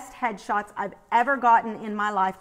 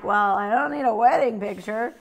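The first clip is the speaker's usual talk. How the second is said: wry inner thought; 5 dB louder than usual. Retold or imitated. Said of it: "And I'm like".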